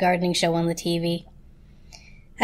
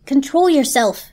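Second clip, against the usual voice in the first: stern; 5 semitones higher, 5 dB louder than usual. A narrator's reading. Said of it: "said the toaster sternly".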